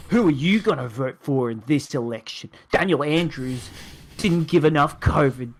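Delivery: normal talking voice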